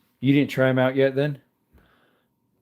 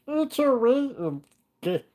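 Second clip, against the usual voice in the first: high silly put-on voice. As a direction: parrot the chat line in a goofy cartoon voice. Repeats chat line in silly voice.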